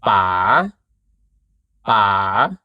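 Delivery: PA starting low, swooping up at the end; rising tone. Shown as a demonstration. Teacher modelling Thai rising tone, low then rising. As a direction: keep "pa" low, then lift it. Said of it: "rising tone start low".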